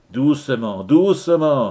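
acted command, building on the repeat; restraining call. A narrator's reading. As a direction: rein someone in with a rising, insistent repeat. Chief demon restrains an eager demon.

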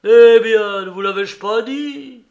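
loud, high, overjoyed outburst; delighted exclaim. A narrator's reading. Father greeting Maximov, loud and delighted. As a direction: cry out, carried away with glee. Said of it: "s'écria Fiodor Pavlovitch, tout transporté".

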